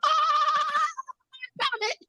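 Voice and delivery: very high squeal of discovery; excited friend impression. Mimicking her recruit solving it herself, high delighted squeal. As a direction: squeal in a silly put-on voice. Imitates Brooke finding the answer.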